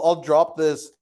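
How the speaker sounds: usual speaking voice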